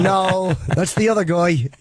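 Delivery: a quick hint of an Irish brogue on the denial; Irish accent. Answering a caller as the old Irish actor, in a light Irish accent. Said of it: "That's my Irish accent".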